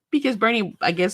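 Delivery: usual speaking voice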